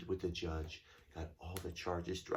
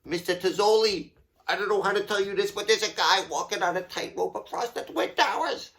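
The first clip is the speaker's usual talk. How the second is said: panicked janitor; 11 semitones higher, 12 dB louder than usual. Retold or imitated. Janitor quoted, high and fast.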